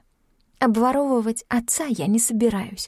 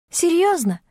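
радостный возглас, высоко и звонко; вспыхни притворной радостью; обрадованно; 10 semitones higher than usual. Acted. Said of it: "обрадовалась я". (насмешливо-радостно, намного выше)

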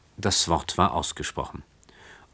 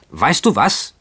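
higher, louder exasperated outburst; irritated shout; 6 semitones higher, 5 dB louder than usual. A narrator's reading. Young cousin shouts at the girl in exasperation, loud and high. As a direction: snap it out, provoked. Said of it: "schrie der Gereizte".

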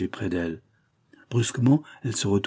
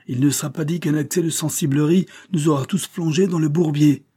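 high, louder indignant tirade; exploding in raised-voice indignation; angry outburst; 7 semitones higher, 5 dB louder than usual. A narrator's reading. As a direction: let the pent-up exasperation burst out. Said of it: "éclatant à la fin".

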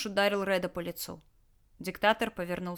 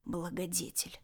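much softer, contemptuous; sneering through teeth; 8 dB quieter than usual. Acted. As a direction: spit the word out quietly with scorn. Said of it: "процедил Ред".

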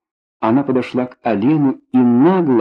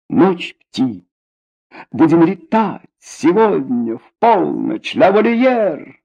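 booming biblical narration; 7 semitones higher, slower than usual. A narrator's reading. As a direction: proclaim it grandly, drawing it out. Booming party invitation.

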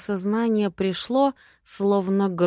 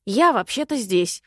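offended; 3 semitones higher, 4 dB louder than usual. Acted. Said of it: "обиделся мистер Синеглазка".

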